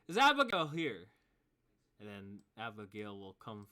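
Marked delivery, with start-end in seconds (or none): 0.1-1.0 loud high call across the town. Acted. Wildfire calling out loudly for Abigail. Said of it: "you hear Wildfire says".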